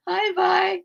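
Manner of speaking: bright lifted hello to a friend in chat; excited greeting. Real, not acted. Greets viewer again after laughing.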